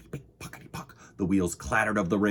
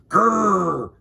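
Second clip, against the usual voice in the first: short, loud bear growl; quick raised-pitch bear "grrr"; 10 semitones higher, 9 dB louder than usual. A narrator's reading. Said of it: "said the bear".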